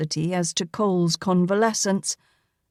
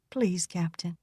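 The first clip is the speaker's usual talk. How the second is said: much softer, teasing request; soft coaxing; 8 dB quieter than usual. A narrator's reading. Nurse coaxing the captain to use her first name, softly. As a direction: murmur it to coax him.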